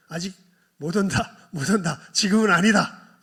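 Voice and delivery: loud, high, driving sermon voice; impassioned pulpit climax; fiery preaching. Acted. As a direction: preach it with full force. Preacher at full force.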